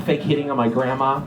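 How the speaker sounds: normal talking voice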